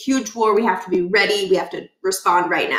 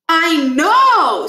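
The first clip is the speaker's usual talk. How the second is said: put-on much higher, louder proud kid voice; Stuart's voice; 8 semitones higher, 8 dB louder than usual. Stuart announcing the locker trade, proud and high.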